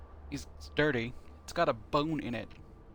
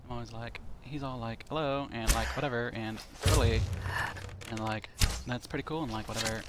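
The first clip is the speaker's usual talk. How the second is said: put-on a playful, mild Valley Girl accent with lots of "like". Shown as a demonstration. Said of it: "That's kinda my Valley Girl accent".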